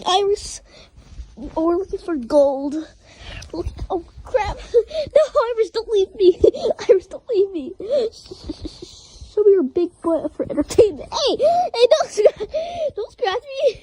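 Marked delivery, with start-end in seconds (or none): 4.6-13.8 high, laughing cries to the cat; laughing pleas. Real, not acted. Cat scratching him as he laughs, high laughing pleas. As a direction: plead through laughter as she claws.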